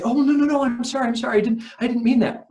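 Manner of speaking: high-pitched, flustered mock apology; acted-out frantic pleading to take it back; panicked backtracking. Voicing a panicked backtracking reply.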